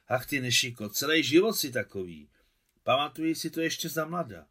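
a hint of a reproachful grandmother; scolding old woman. A narrator's reading.

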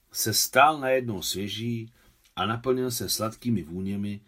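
usual speaking voice

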